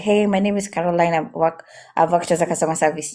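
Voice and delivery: hurried self-introduction with words run together; nervous, very fast. Shown as a demonstration. Job coach shows how nerves spoil an introduction, rushed and swallowed. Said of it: "when I'm nervous, I talk very fast".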